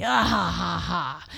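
big staged "ha ha ha"; loud lion laugh. A narrator's reading. Lion mocking the tiny mouse, laughing loudly. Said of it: "Lion laughed loudly".

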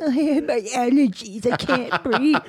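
mocking a whiny stuffed-up voice; lightly voiced complaining crybaby; whiny allergy sufferer. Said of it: "like you crybaby pussies".

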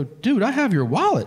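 high, excited revelation; amazed announcement. Retold or imitated. Diner tells waiter he has his wallet, excited. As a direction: burst out with the big news. Breaks amazing news to the waiter.

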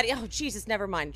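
ordinary talk, nothing marked

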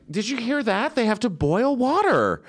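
high-pitched, wide-eyed relaying of news; mock excitement. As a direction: share it like a thrilling luxury, pitched high. Retold naive excitement at the table.

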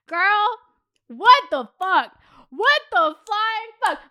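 slow, loud appalled outburst. Retold or imitated. Reacting to date sleeping over, appalled. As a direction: punch each word in rising disbelief.

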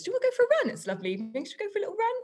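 bright high-pitched breezy invite; chirpy invitation. Retold or imitated. Cheerfully inviting her colleague out for a run. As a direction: sing it out like a cheery suggestion.

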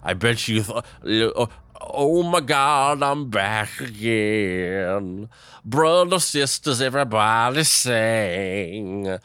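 put-on boisterous drunk hailing the crowd with stretched words; Woodrow Wilson as happy drunk. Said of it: "it's me, Woodrow Wilson, your favorite happy drunk".

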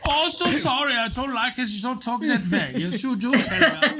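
very high, hammed-up German accent. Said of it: "say you're doing a German accent".

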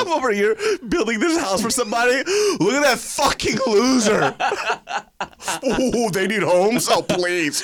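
high loud mocking jerk. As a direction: sneer like a snob.

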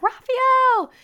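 very high, loud scream of a name. Retold or imitated. Wife screaming for husband, high panicked yell. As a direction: yell for help across the house. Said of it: "screaming bloody murder for my brothers".

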